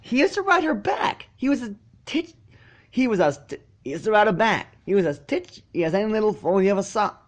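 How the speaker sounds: high insistent filly voice; high and insistent, defending a friend; filly defending Spike to the crowd, high; Sweetie Belle. A narrator's reading.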